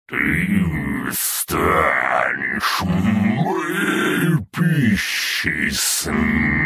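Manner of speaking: slow, gurgling, drawn-out monster voice; gurgling alien voice. A narrator's reading. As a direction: let the words ooze out like bubbling lava. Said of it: "Его голос звучал тягуче и обволакивающе".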